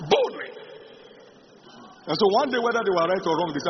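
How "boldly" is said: bold exhortation. Acted. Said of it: "preacher exhorts".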